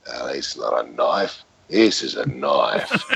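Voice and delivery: deep, slow Australian movie line; Australian accent. Retold or imitated. Actor imitating an Australian film line, low and slow. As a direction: drawl it like a Crocodile Dundee Aussie. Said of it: "that's when the Aussie thing started to hit".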